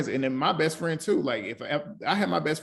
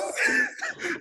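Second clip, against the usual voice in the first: shrieking laugh. Real, not acted. Co-host caught her edit joke, shrieking laugh. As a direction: let a squealing laugh rip.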